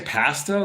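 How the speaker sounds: "pasta" with a short flat A as in cat; imitating the British vowel in "pasta"; British-style pasta. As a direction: say "pasta" as PASS-tuh, like a Brit. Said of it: "like a Brit".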